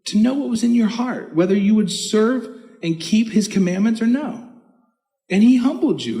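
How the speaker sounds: high, driving pulpit delivery; fervent preaching. Acted. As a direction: preach it with rising fervour. Preaching at full force.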